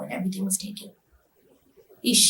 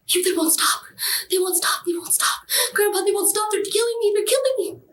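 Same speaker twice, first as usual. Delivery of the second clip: high, desperate plea climbing higher; panicked pleading; 11 semitones higher than usual. Retold or imitated. Terrified granddaughter begging Grandpa, panicked high plea. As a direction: beg for rescue, voice rising with each repeat.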